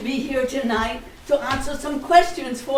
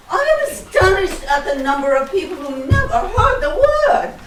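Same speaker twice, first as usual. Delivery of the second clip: loud, higher, theatrically astonished; mock astonishment; 4 semitones higher, 6 dB louder than usual. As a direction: exclaim it in hammed-up amazement, half laughing.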